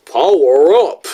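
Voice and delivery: loud, hammed-up mimicry of a clip; mock his catchphrase with an exaggerated swoop; bad impression. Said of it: "still a bad impression".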